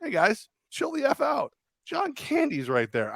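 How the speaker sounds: lightly voiced calm huddle leader; cool quarterback. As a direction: lay it back, unbothered and chill. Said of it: "look and go".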